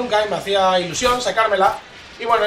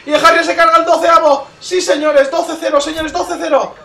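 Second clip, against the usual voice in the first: loud, raised hype voice; excited celebration; 6 semitones higher, 6 dB louder than usual. Real, not acted. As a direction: crow loudly over the kills. Celebrating a killstreak in game.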